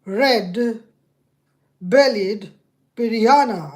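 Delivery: loud, word by word with long gaps; loud voice command. Real, not acted. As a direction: spell it out for a stubborn machine. Repeats command to failing assistant.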